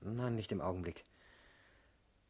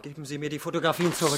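angry demand; 3 semitones higher, 8 dB louder, faster than usual. Acted. Demanding photos back.

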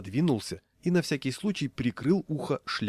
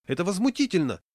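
high, outraged squeal; indignant squeal; 8 semitones higher, 4 dB louder than usual. A narrator's reading. Raincoat man outraged at the conductor, squealing. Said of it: "взвизгнул человек в рыжем плаще".

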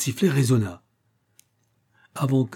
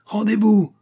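high, loud order; shouted command; 11 semitones higher, 5 dB louder than usual. A narrator's reading. Armed pursuer to the inventor, high shouted order. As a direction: shout for surrender. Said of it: "cria Attison".

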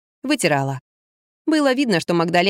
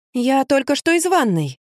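higher, slow, drawn-out reply; timid protest; 6 semitones higher, slower than usual. A narrator's reading. Daughter answering her nagging mother, timid protest. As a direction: answer back in a slow, high little voice.

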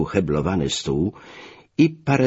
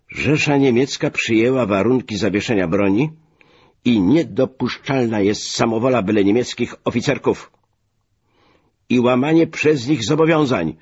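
raised, forceful declaration; higher-pitched, emphatic official rebuke; commanding tone; 7 semitones higher, 4 dB louder than usual. A narrator's reading. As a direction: lay down the terms like an order. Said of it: "rozkazującym tonem".

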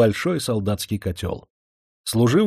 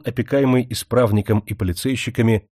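usual speaking voice

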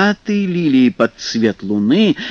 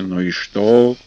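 gruff water spirit; 4 dB louder than usual. Acted. Vodyanoy grumbles at them.

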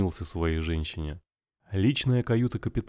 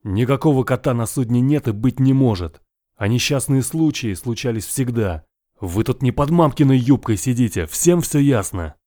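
loud, higher, slowing on the last words; angry captain shouting; 4 semitones higher, 7 dB louder than usual. Acted. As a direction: dress down the crew, full voice. Captain scolds frightened sailors.

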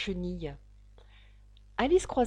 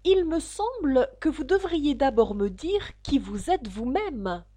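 higher, firm solemn reply; very grave; 6 semitones higher, 3 dB louder than usual. A narrator's reading. Alice drawing herself up to the caterpillar, gravely. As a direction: answer with mild, dignified gravity. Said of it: "répondit bien gravement".

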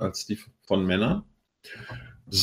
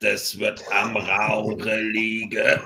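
vocal fry; 2 semitones lower, 4 dB quieter, much slower than usual. Retold or imitated. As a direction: rasp it out slowly. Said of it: "Der Arzt sagt".

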